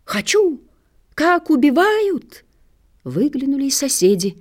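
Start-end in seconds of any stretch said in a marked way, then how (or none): 0.0-2.4 put-on high, excited kid's call; high-pitched childish yell; eager child's cry. A narrator's reading. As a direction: cry out high, wanting to see. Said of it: "Кричит Ганька".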